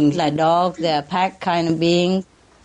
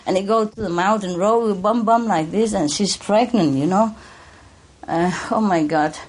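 usual speaking voice

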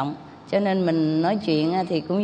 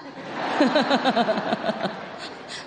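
breathy laugh; 4 semitones higher than usual. Real, not acted. Laughs at own skit.

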